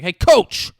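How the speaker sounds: loud, high mock yell with a laugh; yelling at the coach. Dad yelling at the coach across the field, loud and high. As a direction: heckle the coach from the stands. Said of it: "he's yelling at the coach".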